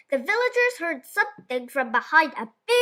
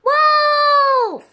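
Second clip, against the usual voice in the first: long, high, loud scream of the word; terrified yell; 12 semitones higher, 8 dB louder than usual. A narrator's reading. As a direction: cry out in real panic. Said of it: "yelled the boy".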